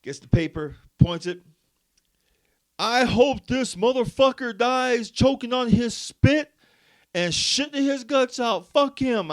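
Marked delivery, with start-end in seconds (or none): 2.8-9.3 loud, high, slow cussing rant; old man's full-volume tirade; imitating yelling old man. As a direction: holler the curse like a half-deaf veteran. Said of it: "why are you yelling".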